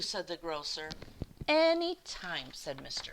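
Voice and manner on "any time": cheerful any time. A narrator's reading. Mr Hatch warmly helps grocer.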